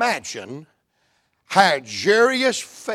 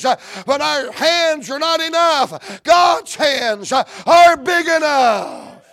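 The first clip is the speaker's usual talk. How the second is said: preaching shout; 6 semitones higher, slower than usual. Acted. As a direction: drive it home to the congregation, slowly. Preaching climax.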